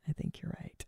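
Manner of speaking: whispered aside, all breath. Real, not acted. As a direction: say it again under your breath. Repeats agreement as an aside.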